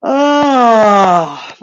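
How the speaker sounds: yawning. Real, not acted. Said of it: "I am yawning and burping".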